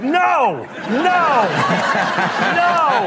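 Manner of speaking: high, loud horrified no, no, no from the wife. Retold or imitated. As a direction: protest like a shocked wife scolding the dog. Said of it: "She was like".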